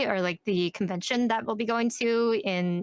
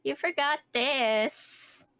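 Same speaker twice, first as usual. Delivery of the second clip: high, drawn-out mock gripe; mock complaint; 6 semitones higher, slower than usual. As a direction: play the huffy client, slowly and higher. Joins in as the huffy client.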